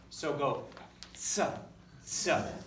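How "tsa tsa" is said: "tsa" with a crisp t sliding into s; ts sound. Shown as a demonstration. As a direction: snap "tsa" like the zz in pizza. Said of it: "like a mixture of a T and a Z together".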